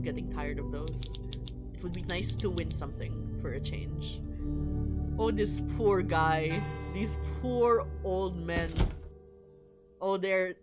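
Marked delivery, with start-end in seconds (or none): none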